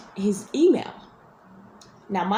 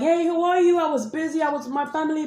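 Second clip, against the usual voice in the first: mock casual guy; 8 semitones higher than usual. Mocking the guy's offhand excuse to her, breezy higher voice. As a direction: play him as the offhand guy brushing off three months.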